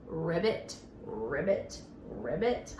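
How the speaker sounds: deep ribbit croak; low frog croak. Acted. Frog sound for children.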